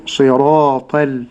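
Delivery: slow صراط ال with heavy ta, thin lam; light lam. Shown as a demonstration. Sheikh modelling the correct صراط ال, slow with thin lam. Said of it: "ترقيق اللام".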